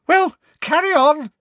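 loud, shrieky high command; high-pitched, hysterical. A narrator's reading. Professor orders the class to start, loud and hysterical. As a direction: snap loudly, on the edge of hysterics. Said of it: "her voice high-pitched and somewhat hysterical".

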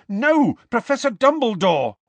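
high, loud pleading cry; shouted protest. A narrator's reading. Boy trying to stop the headmaster confessing, shouting. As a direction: call out urgently to stop him. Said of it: "shouted Harry".

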